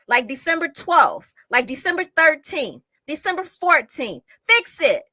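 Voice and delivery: loud and high, building to shouting; angry shouted demands at the police; exasperated rant. Real, not acted. Ranting at police.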